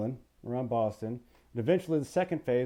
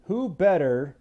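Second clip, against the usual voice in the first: ordinary talk, nothing marked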